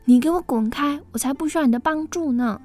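angry. Acted. Tina snapping at the helpful mouse, angry and fast. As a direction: kick the words out at a pest.

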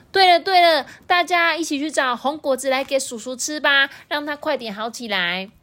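put-on high, sweet little-kid voice; a small dinosaur child, earnest and innocent; baby triceratops. Baby rallies friends.

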